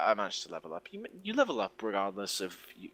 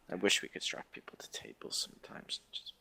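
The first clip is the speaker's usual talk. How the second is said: whispering; 3 semitones lower, 6 dB quieter than usual. Real, not acted. Conspiratorial aside among friends.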